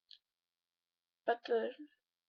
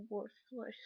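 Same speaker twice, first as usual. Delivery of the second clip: whispered self-loathing; 3 dB quieter than usual. Real, not acted. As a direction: drop to a breathy whisper on the last word.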